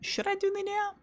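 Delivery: high, playful question; high playful teasing. Real, not acted. Teasing chat about doing nya.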